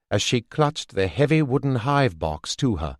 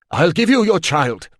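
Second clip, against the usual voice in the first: urgent shout; 6 semitones higher, 6 dB louder than usual. A narrator's reading. Stout man gives in.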